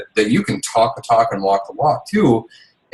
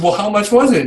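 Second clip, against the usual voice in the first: much higher, wary question about the cost; husband asking his wife the price, sounding alarmed; 9 semitones higher than usual. Retold or imitated. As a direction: brace yourself and ask how much it cost. Asks price of surprise purchase.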